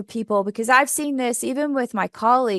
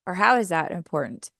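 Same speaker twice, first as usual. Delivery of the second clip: usual speaking voice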